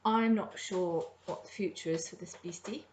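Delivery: ordinary talk, nothing marked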